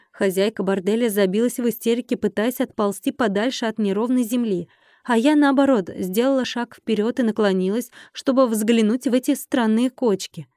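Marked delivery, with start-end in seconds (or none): none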